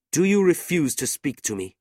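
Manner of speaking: high, loud demand; indignant challenge. A narrator's reading. Brother confronting his sister's insulter, indignant. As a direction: challenge him, pitched right up. Nicholas challenges Sir Mulberry.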